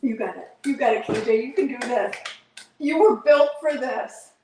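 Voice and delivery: loud, rallying herself on; self pep talk. Real, not acted. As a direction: coach yourself through it. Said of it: "self pep talk".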